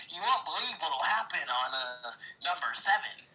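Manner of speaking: high, hyped clickbait teaser voice; clickbait video narrator. Pretend. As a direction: tease it like a cheesy countdown video. Joins clickbait teaser bit.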